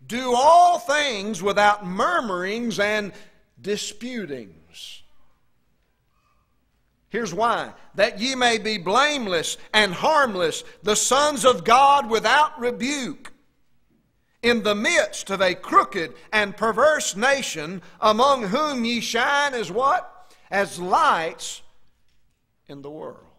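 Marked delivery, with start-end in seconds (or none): 0.1-5.0 slow, loud, drawn-out Bible reading; preaching scripture. Acted. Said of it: "He said". 7.9-23.3 slow, loud, high scripture proclamation; preaching at full force. Acted.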